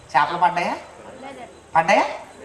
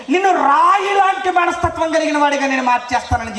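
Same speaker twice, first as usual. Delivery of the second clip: fiery preaching; 8 semitones higher than usual. Acted. Preacher marvelling to the crowd at Peter's tears, fiery. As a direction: proclaim the wonder with full force.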